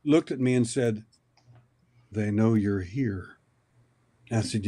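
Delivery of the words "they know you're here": low, slow, drawn-out words; slow motion. Retold or imitated. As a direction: imitate her speaking slowly from half-sleep. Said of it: "in slow motion".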